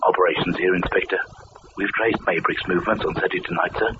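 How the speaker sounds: a junior officer reporting down the line; over the intercom. Acted.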